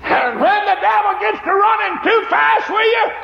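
high, loud, slow shouted preaching; preaching at full force. Acted. Preacher shouting the sermon's climax to the congregation. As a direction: drive it home to the congregation.

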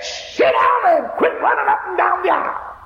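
frantic scolding. Retold or imitated. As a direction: snap at the child in panic. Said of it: "She got frantic and hysterical".

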